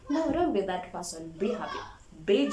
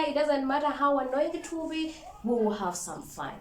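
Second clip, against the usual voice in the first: usual speaking voice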